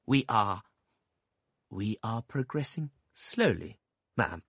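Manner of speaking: slow, hesitant, mild doctor's voice; meek doctor. A narrator's reading. Doctor reporting slowly to a stern aunt, meek and halting. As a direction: tiptoe through it like a timid doctor.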